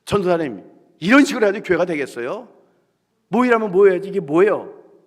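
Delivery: angry rebuke. Retold or imitated. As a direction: blast the pastor for the mess. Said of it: "그 화살이 저한테 돌아와요". (전도사님을 크게 나무라는 회장님)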